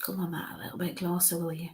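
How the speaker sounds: very soft, near-whispered aside; hushed coaxing. Real, not acted. Medium asking a spirit to step closer, hushed coaxing. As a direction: murmur it privately to someone unseen.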